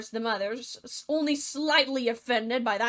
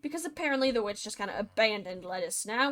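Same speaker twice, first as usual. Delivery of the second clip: ordinary delivery, nothing marked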